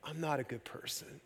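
very soft retold admission; hushed confession. Retold woman's confession to pastor.

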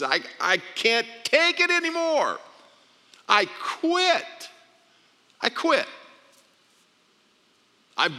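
high, cracking voice of giving up; breaking down. Retold or imitated. Husband on the floor telling his wife he quits, voice breaking. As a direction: let the voice crack and break as you quit. And I'm starting to cry.

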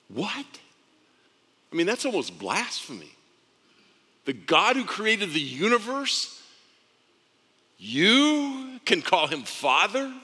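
high, incredulous questioning; swooping up high as if scandalised; astonished disbelief. Acted. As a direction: stretch the words in amazed disbelief. Acts out astonishment at the claim.

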